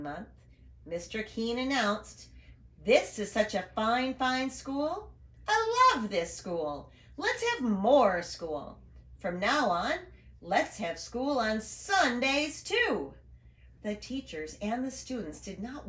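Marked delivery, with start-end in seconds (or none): 2.8-13.1 slow, grand announcement; proud principal. Acted. Principal announcing Saturday school to everyone, slow and proud. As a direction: savour each word of the big announcement.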